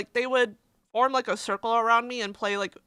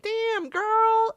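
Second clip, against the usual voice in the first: much higher, hammed-up "damn girl"; a squealing hype reaction, pitched way up; impressed exclaim; 11 semitones higher, 3 dB louder than usual. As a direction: gush at the art like an excited friend. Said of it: "I'm like".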